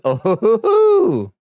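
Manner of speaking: high, rising "hoo-hoo-hoo"; gleeful falsetto whoop; whooping laugh. Real, not acted. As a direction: laugh up into a loud, soaring hoot. Sauce drops into the drink.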